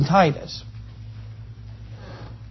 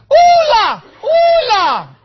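high, loud comic holler, first vowel held long; yelled mock cuss; 8 dB louder, much slower than usual. Shown as a demonstration. Preacher showing how to cuss when hurt, comic high yell. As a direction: yell it out like a stand-in swear word. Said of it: "you just yell".

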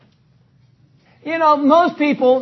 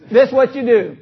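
ordinary talk

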